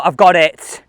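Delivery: normal talking voice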